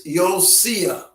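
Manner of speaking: Josiah as yo-SEE-uh; original-style pronunciation. Shown as a demonstration. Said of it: "commonly called".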